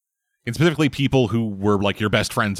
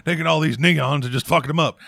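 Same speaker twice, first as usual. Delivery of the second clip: lightly voiced gruff ranting boss; J. Jonah Jameson; 5 semitones higher than usual. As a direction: rant like a cranky editor. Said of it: "J K Simmons as J. Jonah Jameson".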